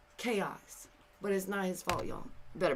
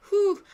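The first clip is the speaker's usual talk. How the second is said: high whew; 11 semitones higher, 12 dB louder than usual. Real, not acted. Exclaiming after the denials.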